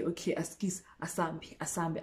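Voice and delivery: hushed, unvoiced okay, askis, asambi; whisper. Guy whispering to the narrator to leave, hushed. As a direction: whisper it to sneak off.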